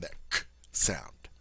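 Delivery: a breathed k, voiceless and hushed; demonstrating the bare k sound; whispered k sound. Shown as a demonstration. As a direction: whisper the hard k to isolate it. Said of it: "that hard C sound".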